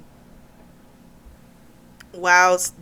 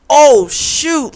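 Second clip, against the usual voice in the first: louder, higher shocked outburst; 7 semitones higher, 9 dB louder than usual. Real, not acted. Teller reacting to the father's-death reveal, loud shocked blurt.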